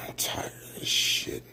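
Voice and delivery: hushed whisper, barely voiced. Real, not acted. Alone after the rant, a seething whisper. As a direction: mutter it under your breath, seething.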